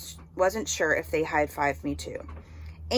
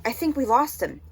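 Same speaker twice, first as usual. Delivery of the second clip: higher, lightly raised call; yelled; 6 semitones higher, 4 dB louder than usual. Acted. Boy calling out after the chase, raised voice. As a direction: call out, breathless relief. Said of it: "someone yelled".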